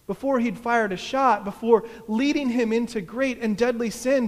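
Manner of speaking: loud, fervent declaration; impassioned confession. A narrator's reading. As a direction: declare it forcefully at full voice.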